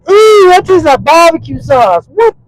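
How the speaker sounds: hammed-up shock at the taste; surprised teacher.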